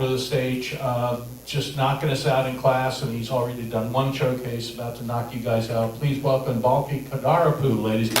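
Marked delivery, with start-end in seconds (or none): none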